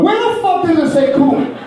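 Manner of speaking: hammed-up outraged husband; exasperated outburst. Husband catching wife changing the recipe, high exasperated challenge. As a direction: pitch leaps up in indignant disbelief. Acted quarrel over the recipe.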